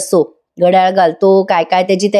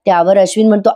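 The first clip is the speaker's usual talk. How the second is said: ordinary talk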